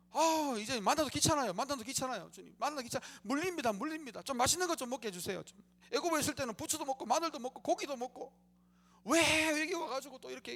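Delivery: high, loud whining grumble of spoiled people; whiny complaint. Retold or imitated. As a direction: whine like a people fed up with manna. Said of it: "막 불평을 합니다". (높고 징징대는 불평)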